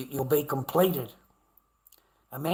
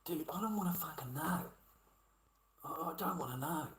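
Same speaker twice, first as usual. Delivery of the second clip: hushed disgust; 2 semitones higher, 10 dB quieter than usual. Real, not acted. Rejecting the confession.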